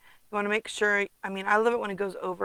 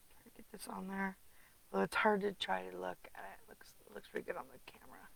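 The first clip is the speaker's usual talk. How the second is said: whisper; 12 dB quieter, faster than usual. Real, not acted. Whispered asides while placing.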